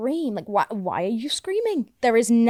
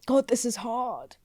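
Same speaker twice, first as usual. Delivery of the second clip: mock self-pity; 4 dB quieter than usual. Acting out self-pity on bad days.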